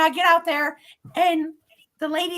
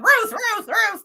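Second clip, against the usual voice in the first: higher, repeated calls of a name; imitated insistent ghost; 6 semitones higher, 4 dB louder, slower than usual. Ghost keeps going on for Ruth, imitated insistently. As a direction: imitate someone pestering with a name. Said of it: "all he's doing is going".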